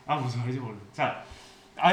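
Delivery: ordinary talk, nothing marked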